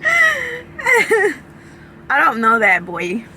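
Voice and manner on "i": laughing. Real, not acted. Laughing outburst.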